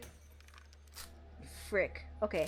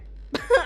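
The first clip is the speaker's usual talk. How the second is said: laugh; 12 semitones higher, 6 dB louder than usual. Real, not acted. Losing the game, laughs.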